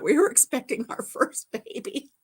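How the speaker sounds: usual speaking voice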